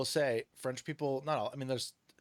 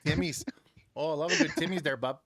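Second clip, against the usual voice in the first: put-on Canadian accent; 4 dB louder than usual. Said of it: "Canadian accent".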